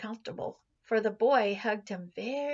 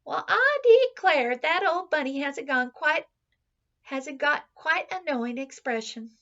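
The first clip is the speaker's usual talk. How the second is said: put-on higher, louder surprised old nurse; nanny noticing the bunny's look, louder; Nana exclaiming; 5 semitones higher, 8 dB louder than usual. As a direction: marvel at the bunny like a startled nanny. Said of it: "and said".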